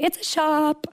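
high, bright mock announcement of a shop; playfully imitating a proud basement tailor; cheery shopkeeper. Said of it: "they'd open the door and say".

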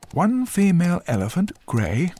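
high, loud desk sergeant reading out an entry; sergeant dictating while typing. A narrator's reading. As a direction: call out each detail as you type it. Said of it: "the sergeant typed as he spoke".

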